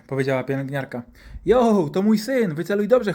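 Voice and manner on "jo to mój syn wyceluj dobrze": high, drawn-out loud objection; acted mum telling him off; indignant mother. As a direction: snap at the doctor to aim properly. Mother yells at doctor.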